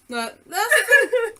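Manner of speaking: breaking into laughter mid-line; laughing. Real, not acted. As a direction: laugh through the words. Laughing while interrupting.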